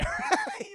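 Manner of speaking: squealing laugh; real laugh at the line; high laugh. Real, not acted. Streamer laughing at Indy's exasperated line.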